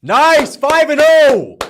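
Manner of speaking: high, loud triumphant yell; victory shout. Real, not acted. Streamer celebrating four and oh, loud triumphant yelling. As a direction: crow about the record at full voice.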